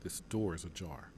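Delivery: low, quiet warning to the squad; hushed. Acted. General quietly warning his squad at the door, hushed. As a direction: keep it down, the enemy may hear.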